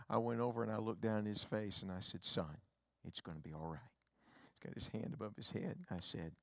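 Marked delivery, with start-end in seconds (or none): 2.2-3.9 put-on much softer and lower, gently soothing a scared child; soothing father. Father calming his scared son in a soft low voice. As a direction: hush and calm a frightened little boy.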